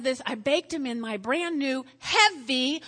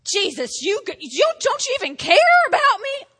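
building to a high, loud, fast outburst; mock complaint to Jesus; 9 semitones higher, 8 dB louder than usual. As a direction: whine rising into an accusing cry. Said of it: "walk up to Jesus and go".

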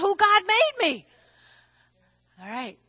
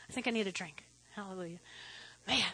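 ordinary talk, nothing marked

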